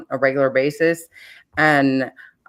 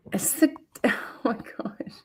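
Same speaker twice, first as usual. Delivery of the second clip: whisper; 7 semitones higher, 9 dB quieter than usual. Real, not acted. Laughing aside.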